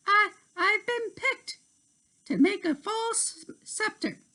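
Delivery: high, eager fairy voice; Tink's voice. A narrator's reading. Tink telling her friend Terrence her news, high eager voice. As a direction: lift into Tink's bright tone.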